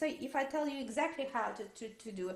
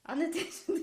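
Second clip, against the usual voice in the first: amused laugh; 5 semitones higher, faster than usual. Real, not acted. Teacher amused by student's answer.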